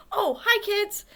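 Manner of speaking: high squeaky puppet chatter. Acted. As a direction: chirp like a little hand puppet. Puppet Schubert talking.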